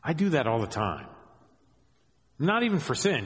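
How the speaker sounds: ordinary talk, nothing marked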